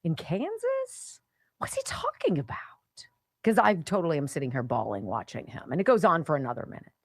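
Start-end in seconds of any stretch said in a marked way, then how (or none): 0.0-2.6 acted bafflement. Host reenacting puzzlement about the little old man, acted bafflement. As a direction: shoot the pitch up in puzzled disbelief. Acts own puzzled reaction to the video.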